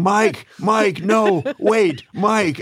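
high-pitched, urgent imitation of panic; retold frantic pleading. David runs to stop the brawl, mock panic. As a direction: call out to stop a fight.

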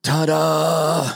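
high, bright punchline flourish; showman ta-da. Retold or imitated. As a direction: sell it like a stage magician. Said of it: "Boffo goes".

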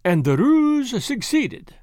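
cheerful. A narrator's reading. Detective tells the nobleman his ruse worked, cheerful. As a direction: announce success cheerfully, drawn out. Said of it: "said Poirot cheerfully".

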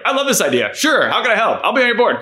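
Andrew, pitched high and eager; a bright, enthusiastic yes from Andrew; eager Andrew impression. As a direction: play him gushing and helpful. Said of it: "And Andrew was like".

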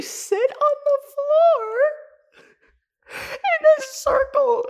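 high, strained laugh-talking; barely getting words out through giggles; breaking up with laughter mid-sentence. Real, not acted. Laughing while recounting absurd prayer.

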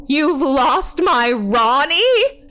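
high, loud, incredulous question; stretched cry rising to the end; aunt's cry. A narrator's reading. Aunt to worker who lost Ronnie, crying out. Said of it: "his aunt cried".

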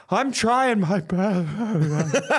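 high, slow, tearful squeak; high slow laughing whimper. Retold or imitated. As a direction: crack and plead through laughter. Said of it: "I just went".

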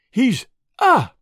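shriek of terror. A narrator's reading. Woman sees intruders in the doorway and shrieks in terror. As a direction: break off into a scream of terror. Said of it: "With a shriek of terror".

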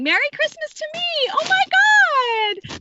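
high, drawn-out squeal of fake glee; mock giddy delight. Mother retelling her prize, mock-thrilled and squealing. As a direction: gush like you just got a gift you hate. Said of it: "I was just blushing".

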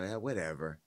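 low, soft, dismissive brush-off; a hint of an unbothered impression, thrown away quietly; Wendy. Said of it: "This is how Wendy would talk".

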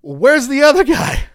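wife demanding where the old singer went, hammed-up; mock wife whine. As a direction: squeal it like the baffled wife. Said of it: "I know, honey".

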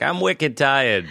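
put-on slow toddler in a Boston accent. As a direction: drawl "wicked" like a Boston toddler. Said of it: "Boston toddlers are like".

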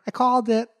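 high smug know-it-all; reporter impression. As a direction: claim credit like a smug insider. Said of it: "John Heyman in the corner".

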